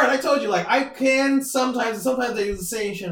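ordinary talk

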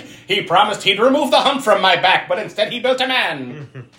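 lightly voiced high, aggrieved henchman; Fritz. Hunchback griping about his master's creation, a hint of high mock grievance. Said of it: "He's like".

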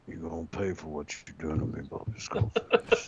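a hint of a creepy threat, trailing to a whisper; lightly voiced menacing stranger, low then breathy; mock threat, dropping to a whisper at the name; menacing message. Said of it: "I sent him a message going".

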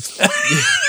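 high, airless giggle; a quick wheezing laugh; breathy laugh. Real, not acted. Wheezing laugh while mocking the movie's plot.

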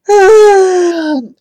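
a big loud exasperated sigh, falling; loud weary sigh. Acted. Jivan, tired of ghost stories, sighs loudly. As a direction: heave a long fed-up sigh.

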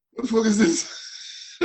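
high-pitched, drawn-out incredulous outburst; mock disbelief. Friend stunned by his CV, in high mock disbelief. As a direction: act out an appalled friend, voice way up.

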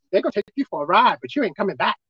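ordinary talk, nothing marked

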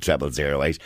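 normal talking voice

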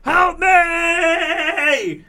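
very high, drawn-out cry for help; screaming. Retold or imitated. Husband waking with leg cramp, screaming for his wife. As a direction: scream it out in agony. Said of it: "I woke up screaming".

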